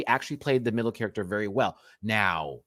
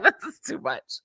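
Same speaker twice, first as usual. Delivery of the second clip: overcome mock reaction; 13 semitones higher than usual. Quoting own giggly reaction to episode.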